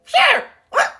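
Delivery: loud falsetto yelp of agreement; hammed-up reaction. Re-enacting her reaction to a friend, hammed-up reaction. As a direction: squeal it far too high, mocking yourself. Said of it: "That's how I re".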